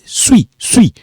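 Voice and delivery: suis said as swi, final s silent. Shown as a demonstration. Teacher drills suis with no s. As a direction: drop the s, just swi. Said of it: "This one we don't pronounce it".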